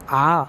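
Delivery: आ held long and slow to show a दीर्घ vowel. Shown as a demonstration. Teacher to students, demonstrating the long vowel, slow and stretched. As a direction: stretch आ out slowly to twice a short अ. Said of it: "तीन दीर्घ अ के".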